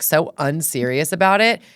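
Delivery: ordinary delivery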